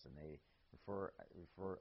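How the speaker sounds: ordinary talk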